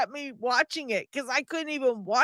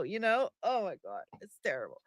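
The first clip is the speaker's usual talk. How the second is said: normal talking voice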